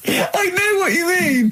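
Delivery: high, slow, squeaky laughing words. Real, not acted. Host agreeing while still laughing hard. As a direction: talk through helpless laughter. Laughing after doctor joke.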